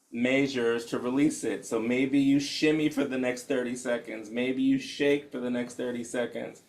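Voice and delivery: hushed, unhurried guide settling the room; guided meditation. Acted. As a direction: ease them into stillness gently.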